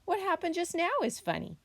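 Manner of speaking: put-on high, quick childlike explanation; young possum voice. A narrator's reading. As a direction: chirp it briskly as the little possum. Little possum explains to bear.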